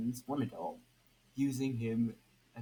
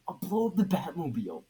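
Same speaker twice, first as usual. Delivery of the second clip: excited threat; 6 semitones higher, 9 dB louder, faster than usual. Acted.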